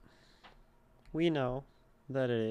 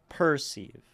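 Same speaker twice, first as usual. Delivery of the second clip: perceive said PER-seeve, first syllable louder; wrong first-syllable stress; 5 dB louder than usual. Shown as a demonstration. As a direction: punch the per- of perceive, drop the -ceive. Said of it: "It wouldn't sound right to go".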